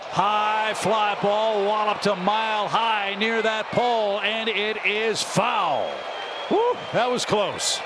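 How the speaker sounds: excited call. Real, not acted. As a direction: call the long fly with building excitement. Home run call goes foul.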